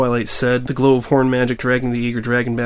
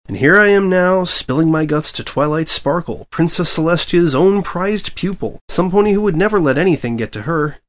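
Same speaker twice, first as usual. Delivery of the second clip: exasperated outburst; 8 semitones higher, 4 dB louder than usual. A narrator's reading. Reporter despairing outburst.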